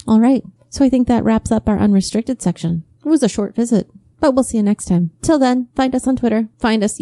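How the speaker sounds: upbeat host outro; podcast outro. Acted. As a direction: wrap up the show like a host.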